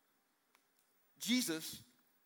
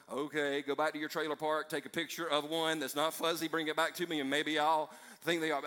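usual speaking voice

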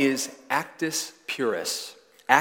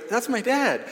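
put-on much higher insistent little kid voice; child's voice; 11 semitones higher, 4 dB louder than usual. Son Jet insisting to the visiting boy, in a high child's voice. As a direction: pipe up high like a small son defending his dad. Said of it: "he goes".

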